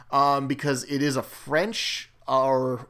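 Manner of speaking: ordinary talk, nothing marked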